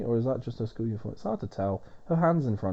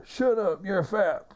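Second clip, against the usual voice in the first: high, loud mock wrestler bark; over-the-top pro wrestler shouting an insult; wrestler promo yell; 9 semitones higher, 3 dB louder, slower than usual. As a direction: ham up a heel wrestler insult. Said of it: "the man who would do the exact same thing".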